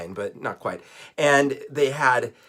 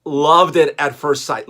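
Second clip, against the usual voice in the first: ordinary delivery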